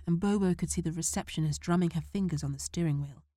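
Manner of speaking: ordinary delivery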